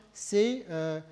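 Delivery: ordinary talk, nothing marked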